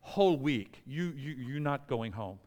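badly imitated French airline clerk; hammy mock-French refusal at the counter; horrible French accent. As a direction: put on a clumsy French accent. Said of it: "That's a horrible French accent".

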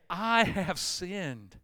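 very slow, weighted words; slow confession. Shown as a demonstration. Preacher urging the church to confess, very slow. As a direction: say each word slowly, facing yourself. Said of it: "the three hardest words".

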